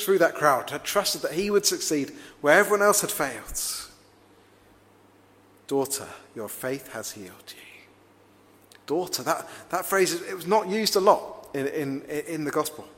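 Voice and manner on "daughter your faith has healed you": whispered softly, like a secret kept close; an intimate whisper of care; tender whisper. Shown as a demonstration. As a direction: breathe it gently to her alone. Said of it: "a real intimate, uh, way of addressing her".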